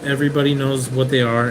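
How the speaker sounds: usual speaking voice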